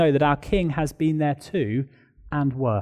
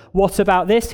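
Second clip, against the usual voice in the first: a louder, higher, demanding challenge; 6 semitones higher, 4 dB louder than usual. A narrator's reading. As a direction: push the question at God. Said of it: "he demanded".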